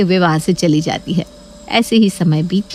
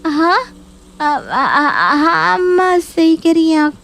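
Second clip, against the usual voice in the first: high, whimpering grudging yes; choked forced agreement; 8 semitones higher, slower than usual. Acted. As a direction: stammer out agreement as if about to cry. Neha forced to agree, choking.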